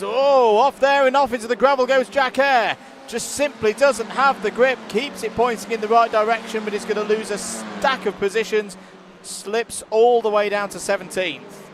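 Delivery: sudden high, loud "oh" as the car goes off; sharp burst of race-call excitement; excited call. Real, not acted. Commentator sees Jack Eyre slide into the gravel, excited and loud.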